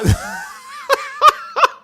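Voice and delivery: high shrieking burst of laughter; cracking up helplessly at the chat; wild laugh. Real, not acted. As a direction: lose it completely, laughing.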